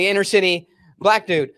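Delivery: ordinary delivery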